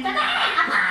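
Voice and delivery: leaps up an octave in a playful laughing Sundanese lilt; Sundanese high lilt. Retold or imitated. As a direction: shoot the voice way up like a Sundanese aunt. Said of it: "gue akan naik satu oktav kalau ngomong gitu".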